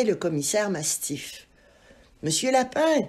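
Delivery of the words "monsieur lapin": «Monsieur Lapin» lancé plus haut et plus fort; héler quelqu'un de loin; appel crié. Acted. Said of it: "cria-t-il à travers la portière". (le commissaire appelle Lapin par la portière, crié)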